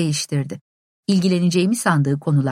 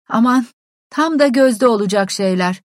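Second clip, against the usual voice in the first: harsh; 6 semitones higher, 4 dB louder than usual. A narrator's reading. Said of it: "dedi sert bir sesle".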